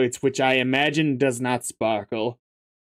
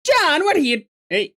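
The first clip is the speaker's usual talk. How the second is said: higher, lightly imitated Wallace Shawn nasal whine; a shaky try at the inconceivable guy; half-hearted Wallace Shawn voice; Wallace Shawn impression. Shown as a demonstration. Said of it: "I'm bad at the voice impression".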